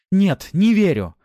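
high, loud, slow; anguished denial. A narrator's reading. Wife rejecting her husband's claim, anguished. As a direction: cry out in refusal. Wife refuses to believe him.

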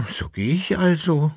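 high, mildly whimpering farewell; whiny, tearful. A narrator's reading. Koppelbär takes leave of Jäckele-Narr, in a tearful whine. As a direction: whine it like a wronged friend. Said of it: "sagte in weinerlichem Ton".

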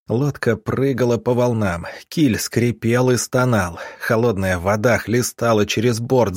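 plain reading voice

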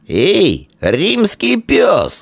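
high, long-drawn jeer; taunting call. Acted. Old foe to Roman boy from the hillock, taunting shout. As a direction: hail him insultingly, stretching it out.

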